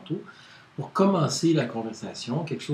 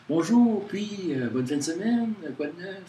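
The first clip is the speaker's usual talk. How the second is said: higher, lively greeting full of warmth; warm expressive voice; 5 semitones higher than usual. Shown as a demonstration. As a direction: greet a friend with real warmth and bounce. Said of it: "une voix chaleureuse". (voix chaleureuse et expressive, plus aiguë)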